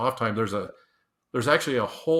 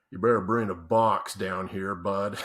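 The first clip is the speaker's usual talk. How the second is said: lower, gruff-sounding worker voice on the radio; a lightly voiced old hand, deadpan; distinctive voice; 3 semitones lower than usual. Veteran worker on the radio telling the boss, lightly voiced deadpan. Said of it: "distinctive voice".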